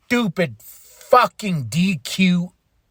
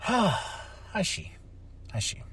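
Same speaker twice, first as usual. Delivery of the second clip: breathy near-whisper, much softer; deflated hush; 14 dB quieter, slower than usual. Real, not acted. Vlogger resigned to viewers after ranting, near-whisper. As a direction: let the air out and barely voice it.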